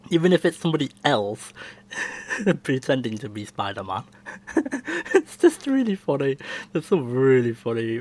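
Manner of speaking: ordinary delivery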